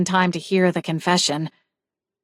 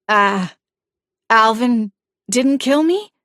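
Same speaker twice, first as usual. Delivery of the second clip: a hesitant "Uh", then a higher, rising unsure question; stammering ghost; 3 semitones higher than usual. Acted. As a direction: stumble into a startled question. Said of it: "Sarah stammered".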